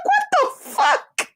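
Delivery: way up high, slow and stunned; high squeal of disbelief. Retold or imitated. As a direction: shriek it in disbelief. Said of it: "I said".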